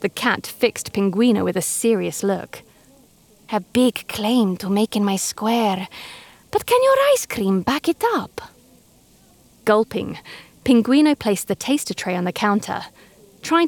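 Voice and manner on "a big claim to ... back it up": lightly voiced cat testing the penguin; menacing cat. A narrator's reading. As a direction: rival cat challenges the penguin, slow and sly. Cat challenges.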